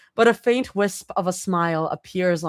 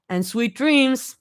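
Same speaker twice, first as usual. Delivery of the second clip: calling out; 7 semitones higher, slower than usual. A narrator's reading. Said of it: "Mira calls out".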